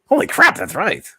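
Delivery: high, loud exclaim in pretend triumph; mock excitement. Pretending to have cracked the seagull riddle, loud high excitement. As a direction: burst out like you just solved it. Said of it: "then been like".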